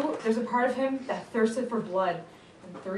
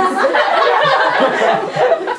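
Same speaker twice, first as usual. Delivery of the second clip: much louder, high laugh burst; roaring with laughter; shrieking laugh; 14 dB louder than usual. Real, not acted. Big laugh.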